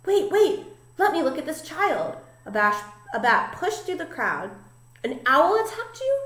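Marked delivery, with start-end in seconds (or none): 0.1-2.2 put-on urgent older bat. Elder bat pushes through crowd. 5.0-6.2 shocked. Acted. Mother bat shocked at story.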